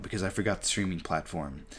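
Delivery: ordinary talk, nothing marked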